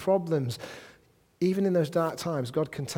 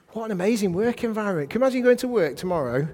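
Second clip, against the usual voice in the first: quick, higher, brighter with amazement; excited wonder; 6 semitones higher, 4 dB louder, faster than usual. Real, not acted. Preacher to congregation, amazed and quickening. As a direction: marvel at it, picking up speed. Preacher marvels at workplace blessing.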